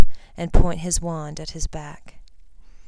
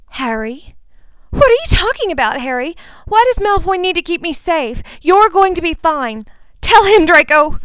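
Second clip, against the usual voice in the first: put-on panicked girl voice; 12 semitones higher, 9 dB louder than usual. A narrator's reading. Ginny panics over Harry.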